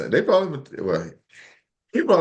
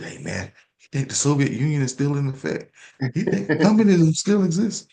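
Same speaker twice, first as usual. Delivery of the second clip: put-on old conspiracy guy; 3 semitones higher than usual. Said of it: "This dude".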